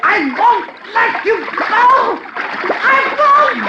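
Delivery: drowning wife clinging to husband, screaming; frantic scream. Acted. High frantic shriek.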